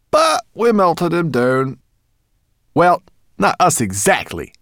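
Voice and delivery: high, loud, cheeky brownie voice; squeaky brownie. A narrator's reading. Brownie answering the dragon, high and cheeky. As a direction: pipe it up like a bold little brownie.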